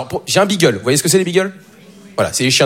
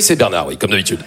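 normal talking voice

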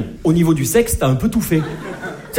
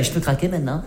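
lower, softer timid voice; timid asking; 3 semitones lower, 6 dB quieter than usual. Retold or imitated. Man asks partner permission to climax, soft timid voice. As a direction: ask permission sheepishly. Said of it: "On est comme ça".

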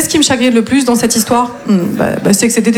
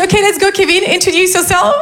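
higher, cheery classroom English; prof d'anglais enjouée; 7 semitones higher than usual. Acted. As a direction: call on a pupil in a raised teacher voice. Prof s'adresse à l'élève en anglais. (prof d'anglais, voix haut perchée)

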